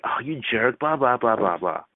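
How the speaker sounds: lightly voiced girl protesting a tease; a hint of a teased girl's protest; mock-offended girl. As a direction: play at being offended, trailing into blahs. Voicing the girl's reply to teasing.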